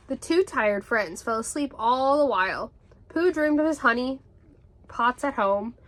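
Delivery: ordinary delivery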